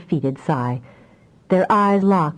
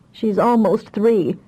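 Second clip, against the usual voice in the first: absent correction; 4 semitones higher, slower than usual. A narrator's reading. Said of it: "corrected him almost absently".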